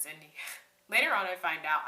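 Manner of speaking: ordinary talk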